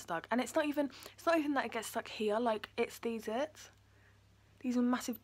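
lip pulled down by finger; talking while showing braces; finger in mouth. Real, not acted. Seen 1: finger hooks lower lip to show braces during speech.

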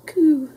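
very high infant coo; piping little "coo"; baby ghost coo. A narrator's reading. Baby Ghost heard by waiting crowds, cooing.

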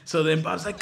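ordinary talk, nothing marked

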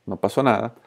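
ordinary talk, nothing marked

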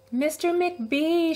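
high, slow call for help. A narrator's reading. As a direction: call down the hall, drawn out. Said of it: "she called after a minute".

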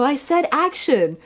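a hammed-up director losing patience, shouted slowly; mock angry shout. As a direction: director yelling across the dunes, mock annoyed and slow. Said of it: "And he'd go".

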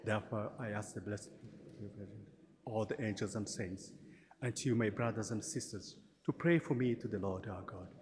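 soft, low, humble prayer recitation; hushed penitential prayer. Acted. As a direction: murmur the confession prayerfully. Recites the Confiteor.

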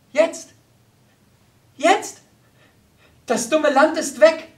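high, loud cries of delight; excited exclaiming. Acted. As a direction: call out elated from the waves. Carried into sea, exulting.

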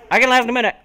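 loud self-encouragement. Real, not acted. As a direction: pump yourself up and hit "last".

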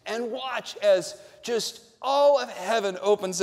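rally the congregation to give, full pulpit fire; preaching at full force. Acted. Pastor urging the church to give, fiery preaching.

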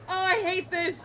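high, loud distressed cry; panicked shout. Real, not acted. Streamer hates the scare, shouting in panic. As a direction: let the fear burst out.

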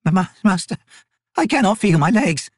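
high, stuttering terrified cry; stammering panic. Acted. Crippled old man begging his god, stammering in terror. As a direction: stammer out in sudden fear.

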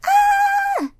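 short high yelp of fright; sudden loud, high-pitched scream; startled shriek. Acted. Caught admirer is startled by darling, startled shriek.

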